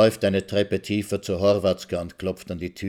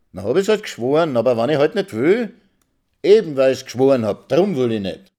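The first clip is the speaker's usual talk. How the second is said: loud and higher, quick and cheeky; defiant retort; 5 semitones higher, 6 dB louder than usual. Acted. Wife defying her husband in the quarrel, loud and defiant. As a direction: throw it back in his face.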